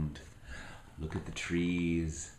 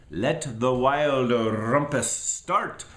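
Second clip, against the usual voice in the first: slow and louder royal proclamation; Max cries out; 5 semitones higher, 7 dB louder, slower than usual. Acted. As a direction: proclaim it slowly to the wild things. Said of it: "cried Max".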